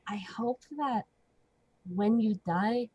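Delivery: soft breathless threat; menacing whisper. Acted. Ophelia threatening the old man up close, whispering. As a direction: whisper it sweetly into his ear. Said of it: "put a hand on his shoulder and just go".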